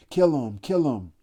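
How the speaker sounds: high, loud frenzied yell; frenzied shout. A narrator's reading. Ralph caught up in the mock hunt, a frenzied loud yell. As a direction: scream it, carried away. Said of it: "carried away by a sudden thick excitement".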